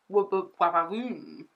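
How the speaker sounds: playful hyped-up whoops; excited whoop. Pretend. Showing viewers the lashed eye, playful excited whoops.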